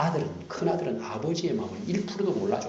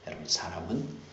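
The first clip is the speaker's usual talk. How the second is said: soft whispered appeal; 5 semitones lower, 7 dB quieter than usual. Real, not acted. Pastor whispering to the congregation, hushed. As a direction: lean in and whisper.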